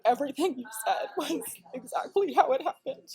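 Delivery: high, tearful voice; choked up, pushing the words out in bursts; getting emotional. Real, not acted. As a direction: voice climbing as real tears well up. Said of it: "I'm crying right now".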